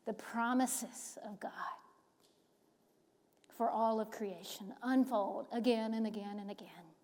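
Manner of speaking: long hushed whisper. Acted. Preacher closing the sermon with a blessing, whisper.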